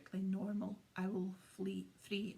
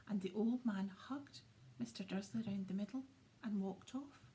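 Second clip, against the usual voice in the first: ordinary delivery, nothing marked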